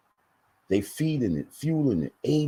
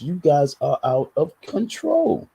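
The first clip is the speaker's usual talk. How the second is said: ordinary talk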